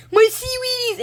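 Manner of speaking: high, loud desperate cry; cartoon kid yelling in high-pitched panic; Arnold's wail. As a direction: shriek like your snack was stolen. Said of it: "he was like".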